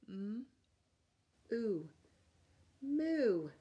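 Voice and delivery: Mm, ooh drawn apart, then moo said loudly; blending sounds. Shown as a demonstration. Teacher blending sounds into the word moo for kids. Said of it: "can you put that together".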